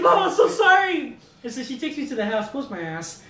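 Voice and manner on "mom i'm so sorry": high, loud, drawn-out mock apology; pleading apology. Son apologizes to furious mom, wailing plea. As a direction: imitate a son begging forgiveness.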